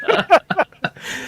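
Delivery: loud, high burst of laughter. Real, not acted. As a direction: let a big laugh out. Laugh after outburst.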